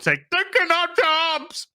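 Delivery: high, drawn-out mock protest; hammed-up high-pitched holler; mock angry mob cry. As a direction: cry it out like an outraged crowd. Mocking protest cry in recap.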